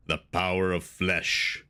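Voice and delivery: low, slow Conan villain voice; slow, deep, deliberate villain line; James Earl Jones. Retold or imitated. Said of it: "James Earl Jones and Conan says".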